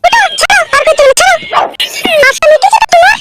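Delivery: high falsetto woman's voice; young woman voice. Acted. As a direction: squeak it up as the girlfriend. Girlfriend voice.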